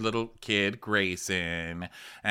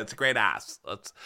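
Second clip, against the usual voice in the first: ordinary talk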